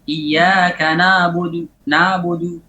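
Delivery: careful recitation; pressed ain. Shown as a demonstration. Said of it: "Harus ditekan sedikit ainnya".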